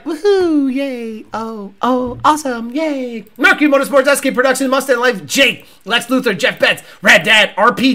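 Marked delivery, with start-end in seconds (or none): 0.0-3.3 feeble, underwhelmed mock cheering; no energy. Host mocks racer's six-second reaction, half-hearted cheer. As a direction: cheer as if you barely care. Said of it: "Where's the energy".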